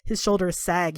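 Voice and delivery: normal talking voice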